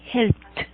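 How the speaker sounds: "helped" in one syllable, helpt; "helped" with no e in the ending. Shown as a demonstration. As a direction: end "helped" on a released t. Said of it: "va a sonar como el sonido de la T".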